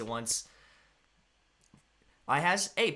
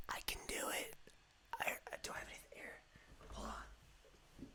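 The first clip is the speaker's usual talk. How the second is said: ASMR whisper. Shown as a demonstration. Streamer playing ASMR for chat, whispering. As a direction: hush it like rustling paper for the mic. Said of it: "if you want an A S M R channel".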